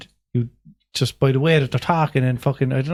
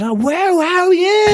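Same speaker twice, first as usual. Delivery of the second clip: high, drawn-out mock shriek; woman calling across road; slower than usual. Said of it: "From the other side of the road".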